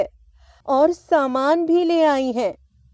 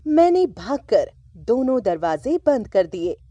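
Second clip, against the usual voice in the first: plain reading voice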